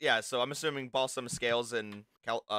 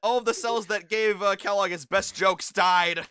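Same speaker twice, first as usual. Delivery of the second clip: excited; 7 semitones higher, 9 dB louder than usual. Real, not acted. Excited outburst.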